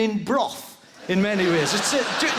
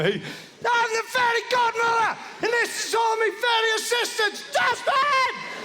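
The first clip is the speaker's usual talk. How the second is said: mock fairy godmother. Comedian playing fairy godmother to the crowd, high hammy voice. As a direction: play a hammed-up fairy godmother. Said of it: "I could never be a fairy godmother".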